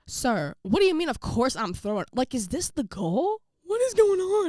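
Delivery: mock outrage climbing high and loud; indignant outrage. Player protesting coach's callous remark, indignant. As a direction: build to an exasperated high shout.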